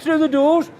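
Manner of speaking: indignant retelling. Retold or imitated. Retold pub argument.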